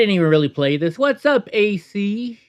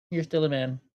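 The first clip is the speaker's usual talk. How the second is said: muttered repeat; 6 dB quieter than usual. Shown as a demonstration. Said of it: "That's all I put".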